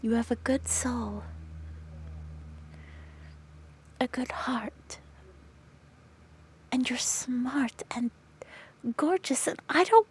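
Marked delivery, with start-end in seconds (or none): 0.0-8.1 tender whisper. Acted. Friend whispers reassurance about her worth, tender. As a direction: whisper it like a promise.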